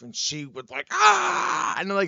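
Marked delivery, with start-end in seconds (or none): 0.9-1.8 high, loud comic shriek; imitated panicky "Ah!"; mock startled scream. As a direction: yelp and flee in mock alarm. Wife's startled reaction acted out.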